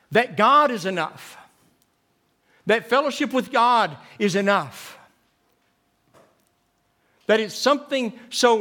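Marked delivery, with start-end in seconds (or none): none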